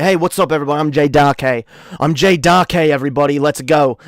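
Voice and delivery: loud British streamer greeting; British accent. Shown as a demonstration. Streamer demonstrating the old intro to viewers, loud British accent. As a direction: announce yourself loudly in a British accent. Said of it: "This is how I'm, I normally".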